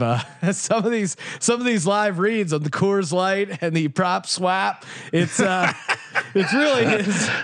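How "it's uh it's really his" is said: laughing. Real, not acted. Laughing at cohost's accent.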